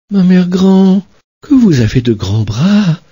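put-on high, amazed little girl; wondering girl. Little girl in bed marvelling at grandmother, high. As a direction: marvel at her arms.

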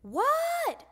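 put-on a kid's high "What?" yelled back; high answering shout. A narrator's reading. Froggy answers mother's call.

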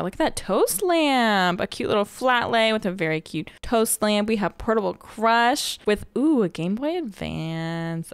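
usual speaking voice

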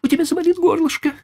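put-on high, fast spoiled boy's voice; whiny child. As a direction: whine it like a pampered little boy. Boy Trilli whining.

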